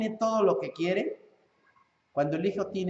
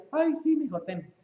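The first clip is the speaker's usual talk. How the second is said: giving-in father; 9 semitones higher than usual. Retold or imitated. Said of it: "Y ahí el papá dice".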